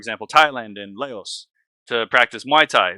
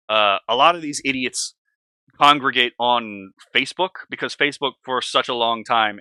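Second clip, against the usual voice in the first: usual speaking voice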